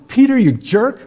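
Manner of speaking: the master pretending to rebuke his disciple, mock scolding high. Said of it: "he didn't chew him out".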